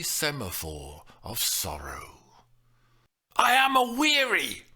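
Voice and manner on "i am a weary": high, loud, vicious snap. Acted. The nurse snapping viciously at Juliet. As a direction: bite the words off in fury. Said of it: "Chloe snapped viciously".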